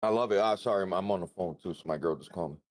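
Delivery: ordinary talk, nothing marked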